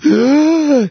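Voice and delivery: loud, high sucked-in gasp; sharp intake of a drowning man; desperate gasp for air. Acted. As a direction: gasp as if surfacing from underwater. Disciple bursts out of the water.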